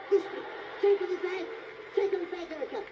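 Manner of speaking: high, loud command; urgent shouted order. Acted. Magician ordering his volunteer to unmask, urgent shout. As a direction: shout it urgently, twice over.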